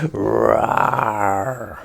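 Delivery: loud growling roar; dinosaur roar. A narrator's reading. The witch as a dinosaur, loud roar.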